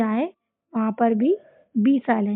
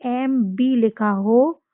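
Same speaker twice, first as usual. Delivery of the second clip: slow spelled letters; much slower than usual. Shown as a demonstration. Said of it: "बी का rule बताती हूँ".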